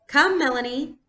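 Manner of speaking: high, bright make-believe lady; playacting doll voice. Girl to her sister, playing dolls, in a grand lady voice.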